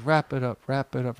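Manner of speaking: soft, fading echo of oneself; hushed replay of own words; voice echoing in memory. Retold or imitated. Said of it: "I heard my voice like".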